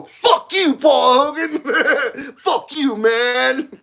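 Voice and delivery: high, laughing mock yell; mock angry heckling. Australians heckling Paul Hogan as they pass, mock angry yell. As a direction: hoot a drawn-out insult in a high mock-outraged voice. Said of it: "They come and go".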